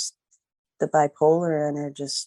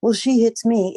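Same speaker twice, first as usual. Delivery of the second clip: put-on higher, louder childish retort; imitating boyfriend; 7 semitones higher, 6 dB louder than usual. Boyfriend defending himself to the mom, higher mimicking retort. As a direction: whine back like a scolded kid. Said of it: "I would tell him".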